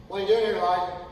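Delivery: loud, booming question from God; God's loud voice. Acted. Preacher voicing God loudly to Elijah, booming. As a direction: call out the question, loud and searching. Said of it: "a voice came to him and said".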